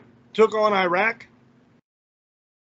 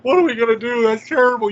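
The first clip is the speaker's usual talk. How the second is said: hammed-up shocked gasping, a bit louder; mock panic; 3 semitones higher, 4 dB louder than usual. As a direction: mockingly play the horrified listener. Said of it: "you might be going".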